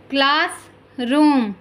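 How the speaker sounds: slow, stretched classroom; slow careful model word. Shown as a demonstration. Teacher modelling classroom slowly for listening pupils. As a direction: draw out classroom for the class. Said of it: "listen to your teacher say the following words".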